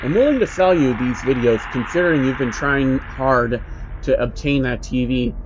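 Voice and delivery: ordinary delivery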